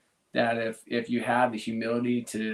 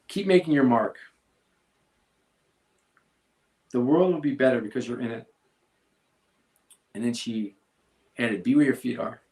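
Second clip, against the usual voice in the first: reading his daughter's note, voice catching; long pauses, fighting back tears, rising toward the end; choked up reading; 4 dB louder than usual. Real, not acted. Father reads daughter's farewell note aloud, choked up. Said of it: "I'll probably get choked up reading this".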